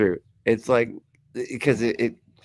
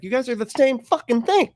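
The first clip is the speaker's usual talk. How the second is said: exasperated retort; 13 semitones higher than usual. Retold or imitated. Mocking sects that divide.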